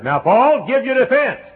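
high, loud mock judge voice; hammed-up accusing judge. Preacher plays the judge demanding that Paul answer. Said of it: "the judge said".

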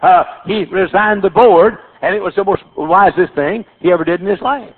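ordinary delivery

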